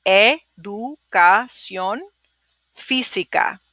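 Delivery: "educación" drawn out very slowly; slow, syllable by syllable. Shown as a demonstration. Teacher slowing down for students, drawn out. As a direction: break it down slowly for the class. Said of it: "Let me hear you say it".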